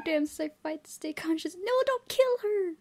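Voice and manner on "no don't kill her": high pleading; frightened plea. Real, not acted. Player begging the ghost to spare her. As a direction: beg it to stop.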